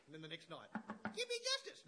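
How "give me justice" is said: high, loud desperate plea of the widow; pleading widow. Retold or imitated. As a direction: cry out for justice like a begging widow.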